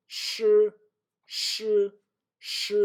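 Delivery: voiceless "sh", repeated separately; not vibrating. Shown as a demonstration. Teacher correcting a wrong guess, unvibrated sh. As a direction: say "sh" as pure air, no buzz. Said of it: "Again, I'm not vibrating".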